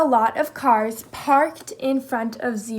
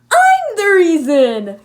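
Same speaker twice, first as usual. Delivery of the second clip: loud, higher, slow joyful announcement; happy call; 6 semitones higher, 10 dB louder, slower than usual. Acted. Moose telling Jackal he is the reason, happy and loud. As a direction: call out proudly and happily. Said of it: "called Moose happily".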